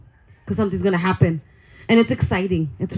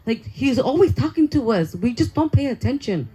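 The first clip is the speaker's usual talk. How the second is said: ordinary talk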